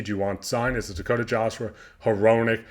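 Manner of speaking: usual speaking voice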